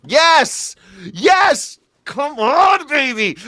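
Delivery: high-pitched, drawn-out victory yell; jubilant cheering. Pretend. Comedian celebrating possible new shutdown, jubilant cheering. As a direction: cheer like your team just scored.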